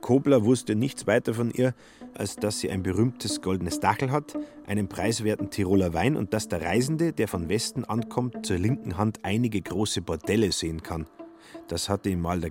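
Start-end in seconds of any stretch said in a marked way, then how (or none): none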